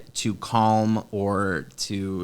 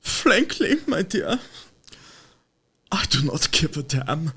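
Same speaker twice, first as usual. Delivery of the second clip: put-on high, halting goofy German accent; silly German boy earnestly reciting a movie line; Augustus Gloop; 4 semitones higher, slower than usual. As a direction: pitch it up, thick German accent, slow at the end. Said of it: "quotes from movies as Augustus".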